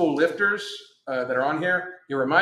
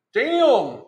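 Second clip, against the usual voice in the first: damn, higher and louder with a falling tune; surprised damn; 8 semitones higher, 6 dB louder than usual. Real, not acted. Learning the stock is nearly gone, a higher, louder surprised damn. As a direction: blurt out damn in real surprise. Reacting to low stock alert.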